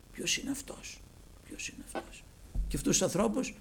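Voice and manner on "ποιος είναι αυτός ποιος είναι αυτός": whispered, soft, suspicious; suspicious mutter. Acted. Scribes grumbling among themselves about Jesus, suspicious whisper. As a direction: mutter it under your breath.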